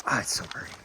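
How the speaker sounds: usual speaking voice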